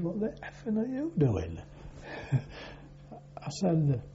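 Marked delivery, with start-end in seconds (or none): 0.0-1.6 higher-pitched mock outraged demand; indignant officer. Officer catches new sailor scrubbing the floor, mock indignant.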